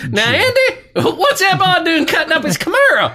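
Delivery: very high-pitched, hammed-up busybody; mock character voice. Co-host asking Andy about the boy cutting up his Camaro, high comic character voice.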